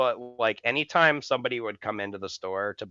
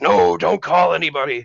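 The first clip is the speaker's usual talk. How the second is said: louder, higher urgent refusal; alarmed refusal; 6 semitones higher, 10 dB louder than usual. Retold or imitated. Injured man begs helper not to call anyone, loud and urgent. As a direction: shut the idea down fast.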